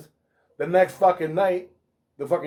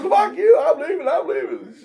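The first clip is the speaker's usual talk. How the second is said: mock panicked; 11 semitones higher than usual. Coworker fleeing, frightened.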